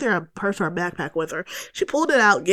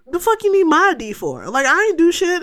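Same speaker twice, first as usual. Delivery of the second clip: high, loud indignant protest; outraged, pitched-up retort; pissed off; 9 semitones higher, 4 dB louder than usual. Retold or imitated. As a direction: re-enact cussing back at a cop. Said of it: "I was so pissed off".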